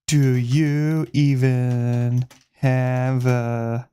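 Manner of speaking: very slow, dragged out; slow drawl. Shown as a demonstration. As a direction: stretch each word out slowly. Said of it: "No, that's so bad".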